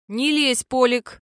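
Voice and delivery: furious woman growling at her husband; growled. Acted. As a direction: snarl at him to back off. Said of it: "прорычала та".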